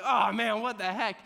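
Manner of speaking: put-on teen panicking as the alarm blares; panicked surprise. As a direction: blurt it in shock. Panicked teen when alarm sounds.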